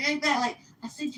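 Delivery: normal talking voice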